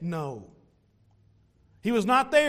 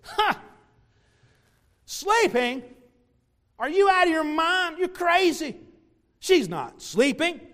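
mocking scorn; 6 semitones higher, slower than usual. Mourners scorning Jesus, high mocking jeer. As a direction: laugh at him as if he's crazy. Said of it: "They would say something like this".

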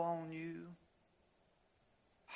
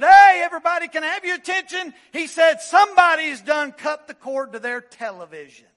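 much louder, higher excited shout; 9 semitones higher, 18 dB louder than usual. Retold or imitated. Preacher bursting in about the cut cord, excited shout. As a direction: whoop it out to the whole room.

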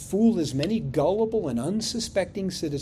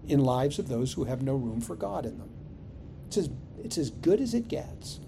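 ordinary talk, nothing marked

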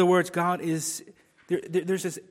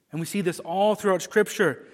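usual speaking voice

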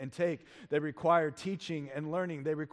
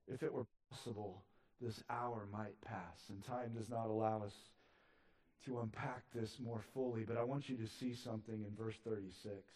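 hushed preaching; 4 semitones lower, 8 dB quieter than usual. Acted. Drops to a hushed teaching voice.

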